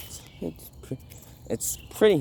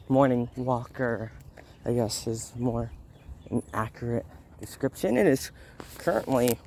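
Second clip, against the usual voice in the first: ordinary talk, nothing marked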